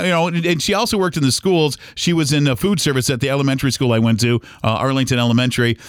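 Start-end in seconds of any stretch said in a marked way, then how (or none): none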